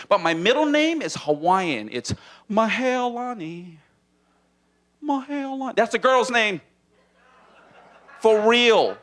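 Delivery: ordinary delivery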